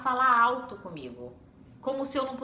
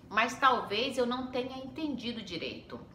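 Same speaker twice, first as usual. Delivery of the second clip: ordinary delivery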